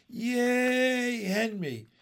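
high, loud drawn cheer, falling on the name; yelling. A narrator's reading. Big boys cheer their schoolmate from a window, yelling. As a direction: cheer someone on at full voice. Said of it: "yelled some big boys".

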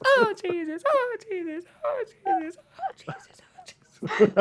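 rising panicked oh Jesus. Retold or imitated. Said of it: "all you hear is".

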